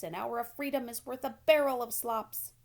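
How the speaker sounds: a goose preaching freedom; goose lecturing. A narrator's reading. Goose lecturing Wilbur about freedom, loud. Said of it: "honked the goose".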